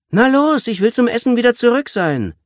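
high, loud nagging; impatient girl's shout; impatient urging. A narrator's reading. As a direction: hurry him along loudly. Girl hurries the hesitating child.

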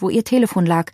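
ordinary delivery

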